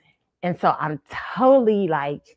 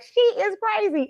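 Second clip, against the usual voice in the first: amused high voice. Retold or imitated. Friends laughing about her.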